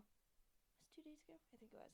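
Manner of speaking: whispered aside to herself; whispering. Real, not acted. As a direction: whisper it, unsure. Unvoiced aside.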